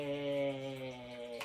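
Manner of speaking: low, soft snail greeting; low slow snail. Acted. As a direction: drawl like a sleepy snail. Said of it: "said the snail".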